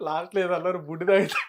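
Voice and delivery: high, loud words through real laughter; laughing. Real, not acted. Interviewer queries guest's last slang phrase, laughing. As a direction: ask while cracking up.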